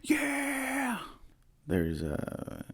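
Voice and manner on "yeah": high-pitched, hammed-up kid excitement; super excited kid. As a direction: squeal it like a thrilled kid, mock-excited. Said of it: "you're very excited, super excited".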